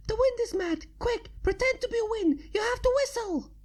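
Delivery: high frantic Pinky voice; quickly. A narrator's reading. Pinky urging Twilight to whistle: high frantic rush. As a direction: rattle it off in a panic. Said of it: "Pinky said quickly".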